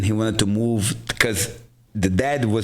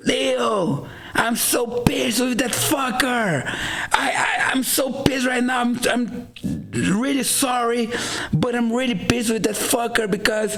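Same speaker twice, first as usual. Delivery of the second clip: agitated agent rant; 12 semitones higher than usual. Retold or imitated. Agent fuming on the phone.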